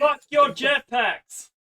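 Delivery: high, slow shouted outburst; mock shout. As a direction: yell it out in mock outrage. Host yells at jetpack fans.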